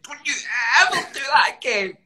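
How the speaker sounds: put-on high, loud child yelling a warning; child's angry outburst. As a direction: play the furious little boy scolding him. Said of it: "He's like".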